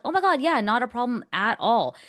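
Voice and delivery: high, loud mock-gracious reassurance; gushing. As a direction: reassure them eagerly, voice leaping up high. Gushing reply to apologetic couple.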